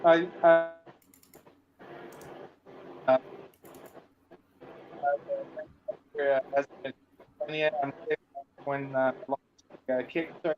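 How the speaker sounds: choppy, glitching call audio; robotic glitchy audio. Real, not acted. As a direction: voice breaking up like a bad connection. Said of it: "you're roboting a bit".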